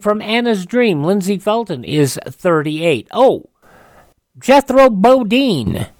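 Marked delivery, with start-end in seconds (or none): none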